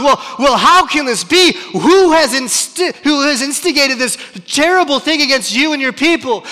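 loud, high, stammering bewilderment; confused outburst. Acted. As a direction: splutter it as a baffled, outraged king. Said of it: "they're just so confused".

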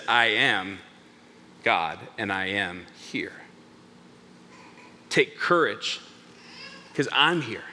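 low, soft, with long pauses; hushed, weighty declaration; solemn reassurance. Acted. Jesus to frightened disciples, solemn reassurance.

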